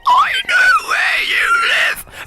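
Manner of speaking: high, slow, shrieking creature shout; creepy monster voice. Shown as a demonstration. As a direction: scream it like a monster. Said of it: "I can try and say, like, something really creepy".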